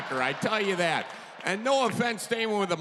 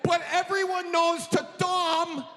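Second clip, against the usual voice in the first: higher and exasperated; frustrated outburst; 8 semitones higher than usual. Retold or imitated. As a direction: snap back in frustration. Escalating frustrated retort.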